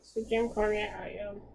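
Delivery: ordinary talk, nothing marked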